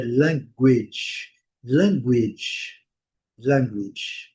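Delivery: "language" as LANG-gwidge, weak ending; careful textbook "language" ending in -gwidge, not -gwa; correct pronunciation. Shown as a demonstration. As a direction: say "language" slowly in two syllables, LANG stressed. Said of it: "Mas a pronúncia correta".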